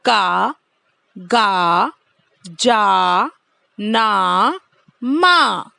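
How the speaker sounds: का, गा, जा, ना, मा each with a clear long aa; teacher repeat-after-me drill. Shown as a demonstration. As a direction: drill the syllables one by one. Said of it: "फिर से एक बार दोहराएंगे".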